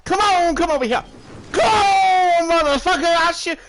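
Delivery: high, loud mock challenge; hammed-up yelling Texan; trigger-happy Texan. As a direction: call out a fight like an itchy gunslinger. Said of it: "They're like".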